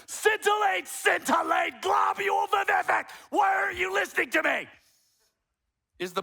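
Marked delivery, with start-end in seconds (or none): 0.1-3.0 "Scintillate, scintillate, globule vivific" yelled much higher and louder; shouted louder. Shown as a demonstration. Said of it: "saying it louder and more". 3.3-4.7 much higher, much louder shout. Shown as a demonstration. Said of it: "saying it louder and more, more emphasis".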